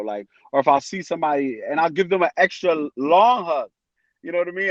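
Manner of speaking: usual speaking voice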